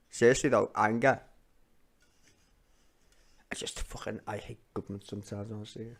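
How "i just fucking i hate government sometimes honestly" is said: much softer, half-whispered frustration; bitter mutter. Real, not acted. Streamer muttering to viewers about government, soft and bitter. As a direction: mutter it under your breath in disgust. Quiet bitter aside after statement.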